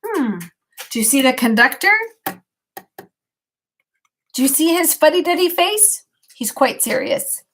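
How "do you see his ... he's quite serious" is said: plain reading voice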